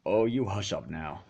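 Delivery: low, soft, embarrassed mutter. A narrator's reading. Macintosh hushes his teasing sister, low muttered. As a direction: grumble it under your breath. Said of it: "he muttered".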